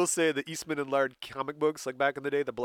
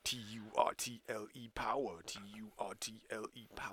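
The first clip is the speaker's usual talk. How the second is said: whispered chant; whispering; 5 semitones lower, 13 dB quieter than usual. Retold or imitated.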